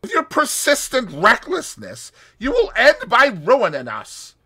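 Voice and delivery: loud, high bitter outburst; a man's bitter loud warning. A narrator's reading. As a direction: spit it out bitterly. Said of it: "explained bitterly".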